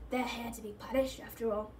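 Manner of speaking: soft, cold reminder. A narrator's reading. Caretaker reminds gamekeeper they're being punished, coldly. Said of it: "said Filch coldly".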